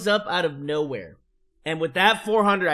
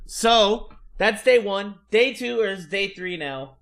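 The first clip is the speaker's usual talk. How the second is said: ordinary talk, nothing marked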